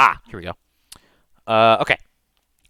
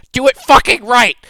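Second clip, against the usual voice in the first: barking the order, much higher and louder; furious yell; 13 semitones higher, 11 dB louder than usual. Acted. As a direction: scream it at the worker on the bellows. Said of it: "and she goes".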